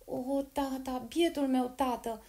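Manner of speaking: put-on a boy lamenting his father; drawn-out sorrowful cry; grieving cry. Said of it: "lăsă să-i scape câteva exclamații".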